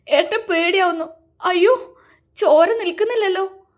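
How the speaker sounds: high, loud, terrified cry; frightened. A narrator's reading. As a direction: panic at the blood that won't stop. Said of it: "പേടിയോടെ പറഞ്ഞു". (പേടിയോടെയുള്ള നിലവിളി)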